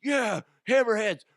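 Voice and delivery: high, loud rallying shout breaking into a roar; hyped-up Little League coach shouting; excited coach yell. Retold or imitated. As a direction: holler to fire up the team. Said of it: "I was so excited".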